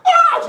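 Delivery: sudden high, loud scream; high-pitched yelp of shock; imitated scream. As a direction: cry out like someone hit by flames. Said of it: "She started screaming kind of like that".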